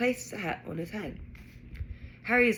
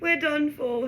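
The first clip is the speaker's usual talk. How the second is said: moan; 8 semitones higher, 5 dB louder than usual. A narrator's reading. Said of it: "he moaned".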